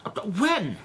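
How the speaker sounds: high, rising panic as he protests; the words tumble out, frightened and high; panicked disbelief. Acted. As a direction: voice climbing in shock. Suspect hears wife feared being killed.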